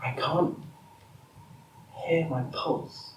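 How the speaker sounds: soft, halting hush; hesitant, very quiet near-whisper. Acted. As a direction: speak under the breath, in broken phrases. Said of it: "Now can you please stop whispering?".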